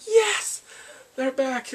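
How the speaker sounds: high, loud celebratory yell; triumphant cheer. Retold or imitated. Fan celebrating the family's return, a loud triumphant cheer. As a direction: cheer it out, then ease slightly.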